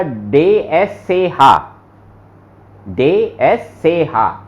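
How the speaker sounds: डे एस से हा spelled out slowly letter by letter; slow spelling. Shown as a demonstration. Teacher slowly spells letters for students. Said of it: "इसको बोलेंगे जय".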